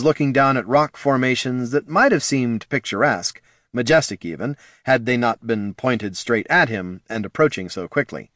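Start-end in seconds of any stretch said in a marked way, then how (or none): none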